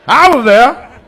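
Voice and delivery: put-on high, loud old-timer boast; old man catchphrase. Old man bragging to the crowd, high and loud. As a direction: shout it like a proud old geezer.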